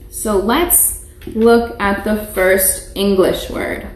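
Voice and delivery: ordinary talk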